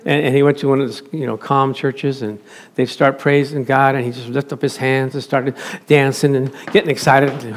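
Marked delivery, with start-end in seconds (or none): none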